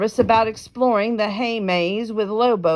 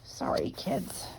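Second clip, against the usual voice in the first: soft unvoiced whisper; 3 semitones lower, 11 dB quieter than usual. Real, not acted. Whispered aside to listeners.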